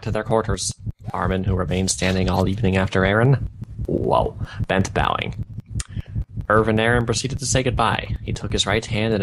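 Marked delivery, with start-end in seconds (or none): none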